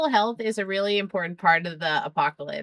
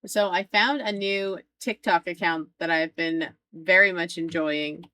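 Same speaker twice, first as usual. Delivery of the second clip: normal talking voice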